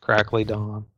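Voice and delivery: crackling, cutting-out line; crackly mic. Real, not acted. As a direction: voice breaking up on a bad mic. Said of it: "You're crackly".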